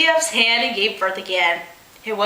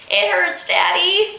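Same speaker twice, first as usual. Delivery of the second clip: crying a little; 8 semitones higher than usual. A narrator's reading. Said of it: "she cried a little bit".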